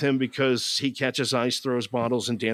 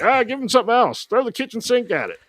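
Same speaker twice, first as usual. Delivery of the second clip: higher, louder, quick mock studio boss; mock writers; 10 semitones higher, 6 dB louder, faster than usual. Imitating the writers scrambling to fix the script, higher and louder. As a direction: toss it off like a frantic writer. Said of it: "They were like".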